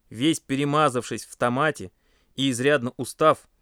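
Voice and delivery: usual speaking voice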